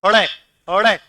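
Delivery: हड़े with a quick tongue-flap ड़; flapped ड़. Shown as a demonstration. Teacher shows students the dotted letter, flapped हड़े. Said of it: "और इसको क्या बोलोगे?".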